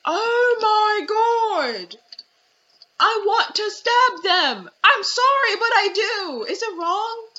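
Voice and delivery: high, put-on voice of a girl wanting to stab someone; mock outraged high voice. Cookie imitated, high mock voice wanting to stab them. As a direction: squeal it like a fake-sweet gamer girl. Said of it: "Cookie's like".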